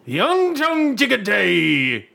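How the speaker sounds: slow, high, booming mock news anchor voice; North Korean newsreader. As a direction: announce it like a state TV newsreader. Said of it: "like the North Korean, uh, newsreader lady".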